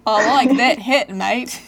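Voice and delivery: loud, drawn-out, hammed-up Australian accent. Shown as a demonstration. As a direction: belt it out slowly in a broad Aussie accent. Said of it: "in an Australian accent".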